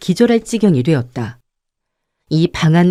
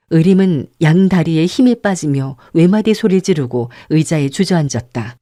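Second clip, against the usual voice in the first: ordinary delivery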